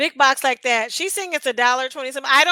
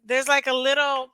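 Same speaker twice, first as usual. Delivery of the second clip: ordinary talk, nothing marked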